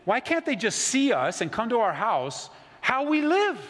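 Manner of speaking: high, loud mock protest; mock indignant question. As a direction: play the outraged neighbor. Voicing a complaining outsider.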